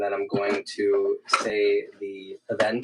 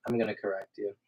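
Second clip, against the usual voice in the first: ordinary talk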